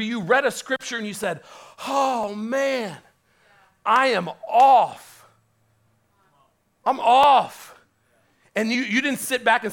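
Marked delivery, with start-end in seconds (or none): 1.9-7.8 loud, slow, anguished admission; stricken realization. Retold or imitated. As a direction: let it land like a blow to the heart. Voicing conviction reading scripture.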